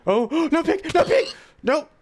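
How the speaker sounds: high, loud panicked cry; real alarmed shriek of no pig; panicked shout. Real, not acted. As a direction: yelp in alarm as the pig is lost. Pig falls or is attacked.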